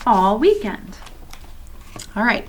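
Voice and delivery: ordinary delivery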